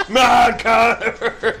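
high, drawn-out hammed-up yell; mock battle cry. Host mimicking Mad Catter's wordless battle cry, hammed-up yell. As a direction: bellow the robot's name slowly.

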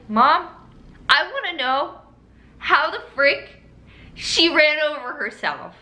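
retold exasperated demand, pitched high; indignant. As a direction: protest to mom in mock outrage, phrase by phrase. Retold indignant answer to mom.

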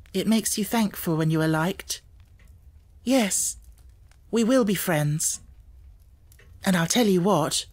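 put-on young girl's voice, warm and sure; gracious young Sarah. A narrator's reading. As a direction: offer friendship kindly. Sarah accepts friendship.

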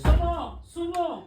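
loud, high call of a name. Real, not acted. Family member calling Sumo across the house, loud call.

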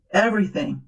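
ordinary talk, nothing marked

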